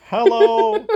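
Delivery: high squeaky robot greeting. Pretend. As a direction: chirp it high like a toy robot. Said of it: "This looks like a robot".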